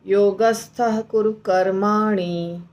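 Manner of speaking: slow, stretched योगस्थ कुरु कर्माणि as in singing; drawn out for raga. Shown as a demonstration. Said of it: "बड़ा करके बोलते हैं". (राग की तरह लंबा खींचकर)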